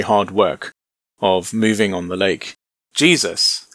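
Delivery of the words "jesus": ordinary delivery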